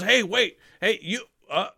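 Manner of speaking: high, loud startled cry, slowing at the end; startled guard. Acted. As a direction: yell it as four bows appear before you. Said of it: "he says".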